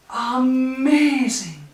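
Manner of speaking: loud, drawn-out amazement; amazed. A narrator's reading. Gruffalo marvels at the mouse, amazed and drawn out.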